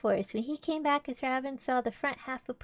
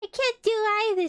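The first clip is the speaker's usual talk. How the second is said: higher, louder Pooh in a fix; lightly voiced Pooh, raised and protesting; distressed Pooh; 11 semitones higher, 6 dB louder than usual. Stuck Pooh complains in the story.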